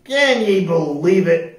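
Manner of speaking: loud, slow pirate complaint; moaning. A narrator's reading. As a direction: moan it in disbelief to a companion. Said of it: "Pete moaned to his parrot".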